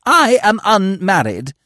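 high, loud, slow affronted protest; indignant astonishment. Acted. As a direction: draw yourself up, scandalised. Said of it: "recoiling in indignant astonishment".

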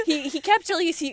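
usual speaking voice

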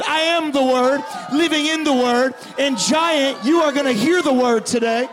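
mighty voice of Jesus challenging the giant; Jesus declaring. Retold or imitated. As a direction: speak as Jesus facing down the giant. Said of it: "Jesus shows up and goes".

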